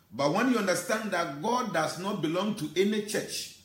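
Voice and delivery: normal talking voice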